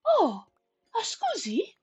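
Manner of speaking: high mock Italian scusi; gentle giant's polite scusi; Italian-ish apology. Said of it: "looks down at you and goes".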